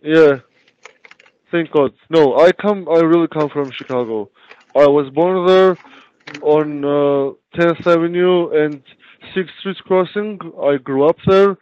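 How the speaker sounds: normal talking voice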